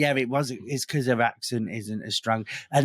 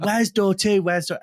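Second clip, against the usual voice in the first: higher, louder insistent asking; louder repeat; 7 semitones higher, 5 dB louder than usual. Retold or imitated. Guest repeating the question louder to the posh hostess. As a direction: say it again, louder and pushier. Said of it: "I went".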